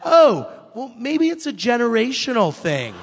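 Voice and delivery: high, loud bright quip; high loud mock-breezy reply; chirpy blunder. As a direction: toss it off cheerfully, too loud. Retold blunder on live TV.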